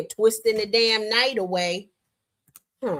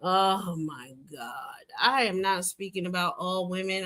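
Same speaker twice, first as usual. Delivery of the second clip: normal talking voice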